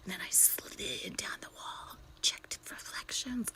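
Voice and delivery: very soft, furtive whisper; stealthy whisper. Retold or imitated. Narrating hiding from pursuers, stealthy whisper. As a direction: whisper it like an action-film fugitive. Acts out hiding like action film.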